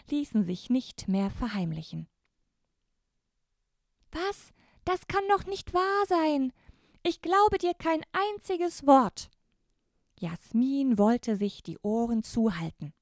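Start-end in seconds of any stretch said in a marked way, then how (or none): 4.1-9.3 put-on high, disbelieving girl's voice, louder at the end; shocked girl. Jasmin refuses to believe her brother, high shocked girl. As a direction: cry out in disbelief like a little girl.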